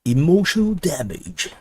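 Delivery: put-on a soft American Chinese accent on "Emotional damage". Streamer repeating the meme quietly, in an American Chinese accent. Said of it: "the American Chinese, the way they talk".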